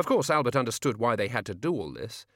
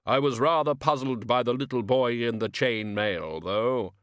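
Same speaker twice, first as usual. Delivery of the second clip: slow grave voice of Death, cheerfully pleased; slower than usual. Acted. Death chatting to Albert after deliveries, calm and grave. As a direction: speak as Death, mild satisfaction.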